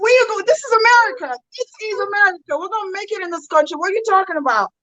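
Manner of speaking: high-pitched, insistent self-talk; determined pep talk. Retold or imitated. Psyching herself up in the mirror, determined. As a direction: push it out high and quick, rallying yourself. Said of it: "I am that aggressive person that I'm, determined".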